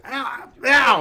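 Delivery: put-on high, loud little girl yelps; childish cries of pain. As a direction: yelp like a hurt child. GM voices Gretel in pain.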